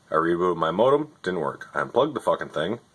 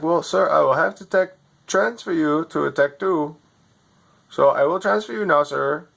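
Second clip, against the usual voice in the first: higher, scripted helpdesk patter; overseas tech support voice; 6 semitones higher than usual. Retold or imitated. Overseas agent reading his script to a customer, lightly mocking. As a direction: read the script like a bored call-centre agent. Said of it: "You're not even in America".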